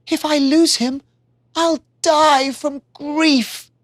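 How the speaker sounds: high, slow, anguished lament; grieving cry. Acted. Iztaccíhuatl fearing she'll lose her love, grieving. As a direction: let the grief pull it slow. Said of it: "Iztaccíhuatl cried".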